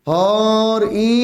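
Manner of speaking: হরিণ held very long, rising; stretched demo word. Shown as a demonstration. Teacher spelling a word for students, drawn out. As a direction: draw হরিণ out so they hear it. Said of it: "হরিণ, রস্বই কি আগে না পরে?".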